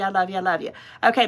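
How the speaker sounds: ordinary delivery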